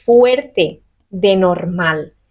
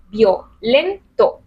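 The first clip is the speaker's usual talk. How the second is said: Violento estirado muy despacio y un poco más fuerte; slow syllable demo; much slower than usual. Shown as a demonstration. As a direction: spell out Violento slowly and firmly. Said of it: "No tiene ninguna fuerza esa I". (Violento muy lento y marcado)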